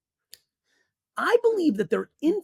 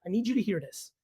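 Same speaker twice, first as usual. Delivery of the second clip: ordinary talk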